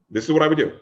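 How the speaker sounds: ordinary talk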